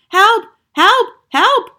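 very high, loud cries for help; screaming. A narrator's reading. Cook begs rescue from a mouse, screaming. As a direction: separate panicked yelps. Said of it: "the man was screaming".

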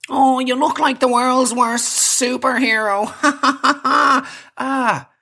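high, slow sneering taunt with fake laugh; mocking heckler. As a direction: imitate a jeering passer-by laughing at you. Mimics a heckler, then mock laugh.

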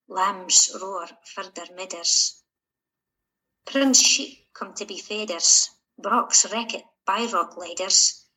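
poem read in Shetland dialect. Acted. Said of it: "in Shetland dialect".

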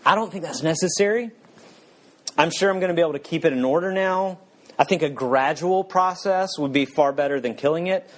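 smooth it over, pitch up and nervous; evasive ghost. Acted.

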